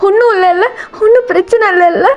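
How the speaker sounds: high, tearful anxious question; pleading and weepy, pitched high; acted crying. A narrator's reading. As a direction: ask for reassurance through sobs. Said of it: "ஏன் அழுகுற".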